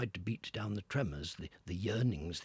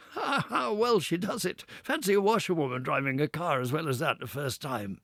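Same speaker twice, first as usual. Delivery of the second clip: laughing gentleman; 6 semitones higher, 7 dB louder than usual. Acted. Gentlemen applauding.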